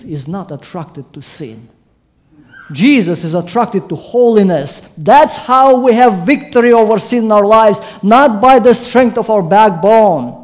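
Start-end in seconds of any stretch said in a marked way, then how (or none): 2.7-10.4 loud, high-pitched sermon climax; building to a forceful, emphatic pulpit cry; full-force preaching. Real, not acted. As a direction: preach it out to the whole congregation.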